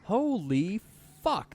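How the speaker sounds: high, loud disbelief; shocked. Real, not acted. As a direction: blurt it out stunned. Shocked at touring news.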